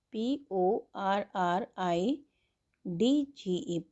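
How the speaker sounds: letters spelled out very slowly; slow spelling. Shown as a demonstration. Teacher spelling porridge for students, slow dictation. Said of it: "teacher spells porridge slowly".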